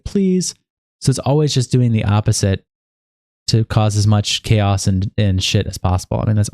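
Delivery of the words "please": high-pitched retold plea; put-on begging "please"; mock pleading. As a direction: plead in a high voice, imitating him. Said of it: "He's like".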